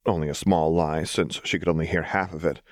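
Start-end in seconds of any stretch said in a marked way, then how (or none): none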